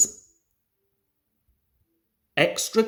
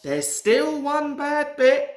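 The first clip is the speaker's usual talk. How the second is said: higher, worried little engine; a touch of worry, pitched up; anxious; 9 semitones higher, 4 dB louder than usual. A narrator's reading. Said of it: "said Rusty anxiously".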